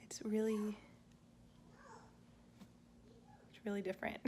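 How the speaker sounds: whispering. Real, not acted. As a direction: keep it to a breathy whisper. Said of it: "putting the kids to bed".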